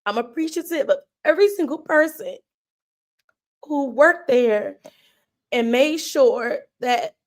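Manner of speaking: holding back tears. Real, not acted. Streamer thanking the park staff, fighting back tears. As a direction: push through the thanks while fighting real tears. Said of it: "get these tears out the way".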